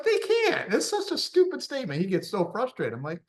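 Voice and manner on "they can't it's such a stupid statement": high-pitched exasperated outburst; mad trainer imitation. Trainer fed up with people who drop out, mock-frustrated. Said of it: "my trainer gets so mad".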